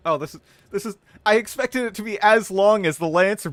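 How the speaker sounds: high, loud, excited rush; thrilled to finally have the context. Real, not acted. Gamer thrilled about the lore entry, excited.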